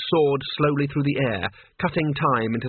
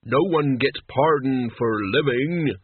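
deathly intoning; slower than usual. A narrator's reading. Mort answers in Death's voice.

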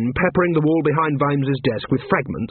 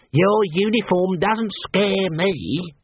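high, slow would-be sneer; young assassin trying to sneer; attempted sneer; 6 semitones higher, much slower than usual. A narrator's reading. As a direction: draw it out with shaky scorn. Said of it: "The young assassin tried to sneer".

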